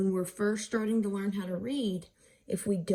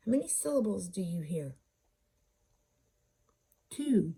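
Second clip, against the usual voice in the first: ordinary talk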